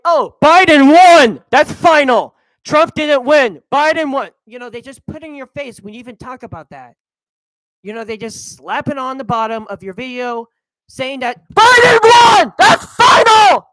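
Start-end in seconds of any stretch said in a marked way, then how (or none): 0.1-4.3 YouTube's verdict hammed up as a loud mock proclamation; mock announcement. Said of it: "YouTube is like". 11.5-13.7 high, loud shouted mockery; shrieking mock version of YouTube's banner. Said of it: "That's what they said".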